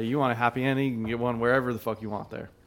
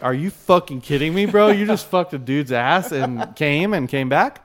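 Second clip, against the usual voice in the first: incredulous; 4 semitones higher, 7 dB louder than usual. Retold or imitated. Teller reacts in shock, laughing.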